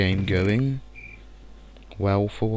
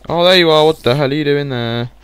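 higher, louder, startled yelling; loud startled shout; 6 semitones higher, 9 dB louder than usual. Real, not acted. Gamer spotting a spider, startled loud shout. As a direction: yell at the spider you just found.

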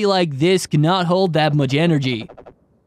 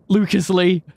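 normal talking voice

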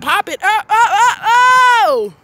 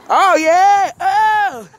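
excited whoop; 5 semitones higher, 5 dB louder, much slower than usual. Real, not acted. Excited at the Chicago trip.

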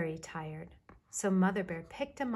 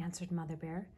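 usual speaking voice

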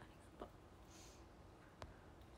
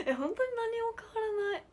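laughing; 11 semitones higher, 7 dB louder than usual. Real, not acted. Child unchanged since last time.

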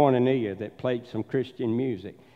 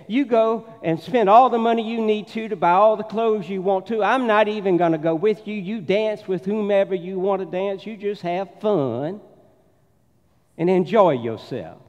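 higher, louder southern belle gushing; southern accent; 4 semitones higher, 4 dB louder than usual. Acted. Southern lady bragging to her friend about her husband, southern accent. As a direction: run it on in a lilting southern accent. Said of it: "get my southern accent back out".

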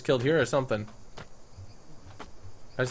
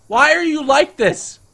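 much higher, louder, slow "why are you like this"; louder exasperated shout; 12 semitones higher, 10 dB louder, slower than usual. Real, not acted. Exasperated at the weird raptor, louder shout. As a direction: escalate the disbelief to a yell.

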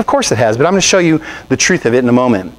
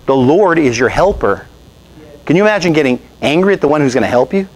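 usual speaking voice

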